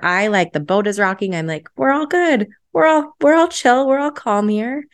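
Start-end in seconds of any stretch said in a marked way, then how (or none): 1.8-4.9 high, over-cheery smoothing things over; pitched-up, placating reassurance; placating peacemaker. Acted. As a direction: act out a peacemaker insisting all is fine. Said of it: "I smooth things over".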